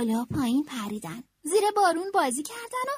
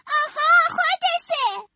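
much higher, louder, slower excited shout; eureka shout; 13 semitones higher, 7 dB louder, slower than usual. A narrator's reading. Franklin realizing how to score, a loud eureka shout. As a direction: burst out with a sudden discovery. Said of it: "فرانکلین داد زد".

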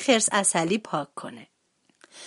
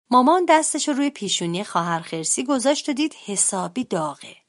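ordinary delivery, nothing marked

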